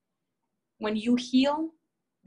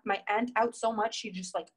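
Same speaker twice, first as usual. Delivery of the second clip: normal talking voice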